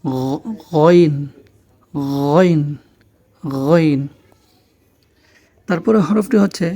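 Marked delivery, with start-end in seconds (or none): 0.0-4.0 গইন opened with a long throaty "gh"; গইন slow, as Arabic "ghoin"; throaty Ghain. Shown as a demonstration. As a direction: demonstrate গইন from where "kh" is made. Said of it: "সেখান থেকে উচ্চারণটি হবে".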